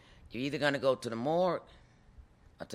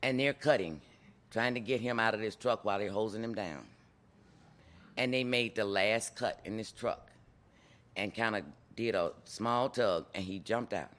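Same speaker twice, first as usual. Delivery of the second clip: ordinary delivery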